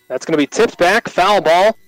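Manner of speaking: normal talking voice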